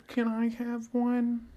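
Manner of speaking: high, soft, slow little voice; mock girlish plea, high and quiet; imitated girl asking. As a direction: mimic a fan's timid request, drawn out.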